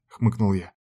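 plain reading voice

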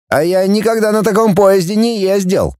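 put-on high, boyish-eager admiration; delighted. A narrator's reading. Kutnov marvels at a train he never rode, delighted. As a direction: marvel aloud with pitch held high. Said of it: "восхитился Кутнов".

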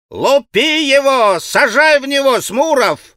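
high, loud, drawn-out yelling; excited boys' shout. A narrator's reading. All the boys urging Smurov to fire back, shouting. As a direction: egg him on in a high boyish holler. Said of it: "закричали все".

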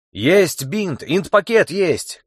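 shout, slowing on the last words. A narrator's reading. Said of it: "крикнул кто-то позади".

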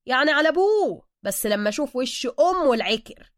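loud, animated street-Egyptian chatter; lightly voiced Suka, brash. A narrator's reading. Suka consoling Bruce with his own hard luck, brash and loud. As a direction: rattle it off like a brash local pal.

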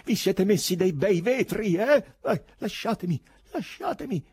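high, struggling shouts; crying out. A narrator's reading. Ivan struggling in the nurses' arms, shouting. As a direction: shout high, fighting to get free.

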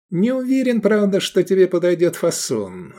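high, hesitant merchant voice; doubtful. A narrator's reading. Merchant unsure the cut suits the customer, doubtful. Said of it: "засомневался торговец".

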